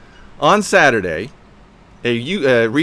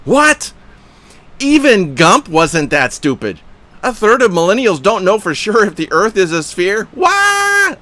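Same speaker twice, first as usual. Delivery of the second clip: high, loud incredulous rant; shouted outrage at a flat earth comment; going berserk; 9 semitones higher, 6 dB louder than usual. Retold or imitated. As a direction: read it like you're losing your temper. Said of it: "I was going berserk".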